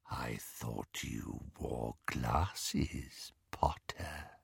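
slow, soft whispered menace; breathed whisper. A narrator's reading. Greyback to Harry, whispered slowly. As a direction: breathe it right in his face. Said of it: "breathed Greyback".

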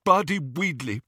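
high, thick, muffled consonants; mouth full of blood. A narrator's reading. Ron giving false name, mouth full of blood. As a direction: talk through a mouthful of blood. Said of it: "his mouth was full of blood".

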